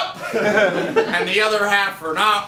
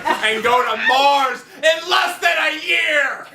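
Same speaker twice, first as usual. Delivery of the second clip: mock-epic build to a high finish; hyped-up boast; 6 semitones higher than usual. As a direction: build it up like a launch announcement. Host building a mock hype boast.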